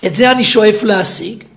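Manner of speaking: loud, slow, hammering each phrase; full-force preaching. Acted. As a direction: preach it out to the hall.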